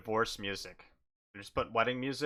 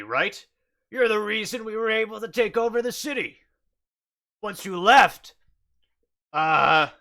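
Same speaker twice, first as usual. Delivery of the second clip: loud, higher-pitched accusing bugbear; brash bugbear; 6 semitones higher, 6 dB louder than usual. Acted. Bugbear recognising the sorcerer who left, brash accusation. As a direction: push it up high and loud, confronting the newcomer.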